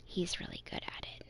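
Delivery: soft, leaned-in whisper. A narrator's reading. Mary leans in to confide about him, whispering. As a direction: whisper it confidentially to one listener. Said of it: "Mary leans in and whispers to me".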